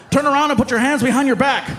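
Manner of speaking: firm officer order; officer playing along; stern cop command. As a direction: bark it like an arresting cop. Cop arrests him.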